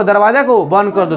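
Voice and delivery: ordinary talk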